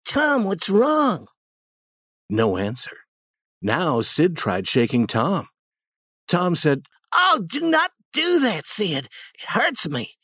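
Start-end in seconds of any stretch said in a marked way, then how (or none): 0.1-1.3 put-on high, drawn-out worried boy's voice; alarmed. Sid to his crying brother Tom, high and alarmed. 7.1-10.2 high boy's voice acting in pain; pained whining. Tom faking a sore foot to Sid, high pained whine.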